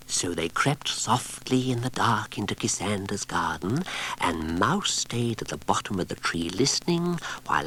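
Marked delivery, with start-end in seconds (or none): none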